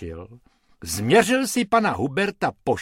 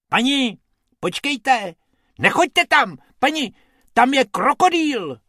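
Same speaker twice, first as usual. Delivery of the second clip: high-pitched warning call; urgent warning; 12 semitones higher, 6 dB louder than usual. Acted. Bob warning the lady about a crocodile, urgent. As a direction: call out to stop someone in danger. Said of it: "varoval jí Bob".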